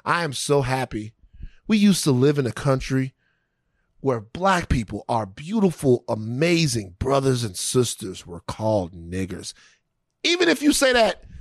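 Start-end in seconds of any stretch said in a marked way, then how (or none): 0.1-9.5 mock speech. Mock Rotary Club speech.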